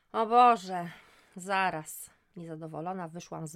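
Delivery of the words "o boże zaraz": loud, exasperated sigh of a word; fed up at being disturbed; annoyed groan. Acted. Friend calls while she bathes, annoyed.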